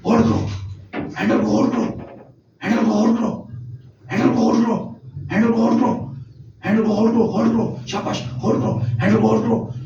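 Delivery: hushed, breathy whispered command; soft whispered instruction to a child; whispering tone. Shown as a demonstration. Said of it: "मैं इसको whispering tone के साथ भी गाऊंगा".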